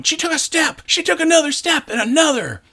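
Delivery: high thrilled squeal of a proud dad; excited. Retold or imitated. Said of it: "staring and going".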